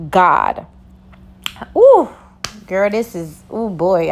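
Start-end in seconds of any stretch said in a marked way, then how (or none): 1.8-2.1 excited ooh. Real, not acted. Reacting to her own topic.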